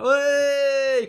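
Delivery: put-on loud, high drawn-out drunken cheer; mock drunken "heeey"; sloshed host. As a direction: holler it like a drunk taking the stage. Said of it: "sloshed when I'm going up on stage".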